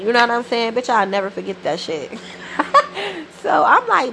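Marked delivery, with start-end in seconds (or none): 2.2-3.4 high laugh. Real, not acted. Laughs at memory.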